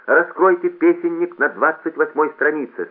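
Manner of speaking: high, loud public-address announcement; loudspeaker announcer. A narrator's reading. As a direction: call it out brightly to a whole hall. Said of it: "сказал громкоговоритель".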